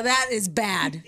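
ordinary delivery

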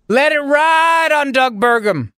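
hammed-up high shout of a bettor; retold shout. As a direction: shout it like a gambler going all in. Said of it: "I said".

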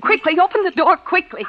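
pressing, rising plea; urgent pleading. Acted. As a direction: beg him to open it now. Urgent demand.

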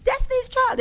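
high, loud mock fan yell; imitated screaming. Onlooker yelling at singers on red carpet, mock screaming. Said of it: "people would start screaming".

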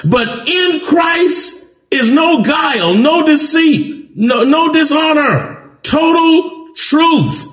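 high, slow fervent preaching; rising preacher's cry. Real, not acted. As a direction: lift it up and hold each word. Preaching at full force, high.

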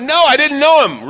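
shouted, high-pitched denial; cursing denial. Acted. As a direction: yell it to prove the point. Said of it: "he ends up cursing".

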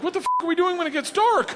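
high, loud mock panic; alarmed yell. Student dreads what Igor plans after dark, mock panicked yell. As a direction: blurt it out in hammed-up alarm.

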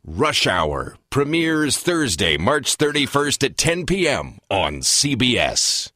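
slow, announcer-style TV promo; promo read. Shown as a demonstration. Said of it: "Do you wanna do the, uh, promo again?".

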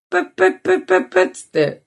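high quick mouth beeps; phone dial tone imitation. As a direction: beep like a phone connecting. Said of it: "[name]さんやり始めたの". (電話の呼び出し音のまね)

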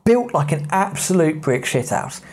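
plain reading voice

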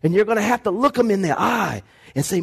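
ordinary talk

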